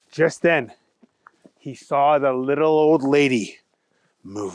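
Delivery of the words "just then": usual speaking voice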